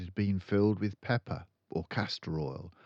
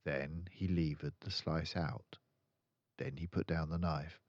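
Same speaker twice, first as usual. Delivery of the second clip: usual speaking voice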